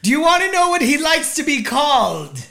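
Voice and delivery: hammed-up public heckle; mock loud announcing. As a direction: holler the question across a theater. Acting out shouting in theater.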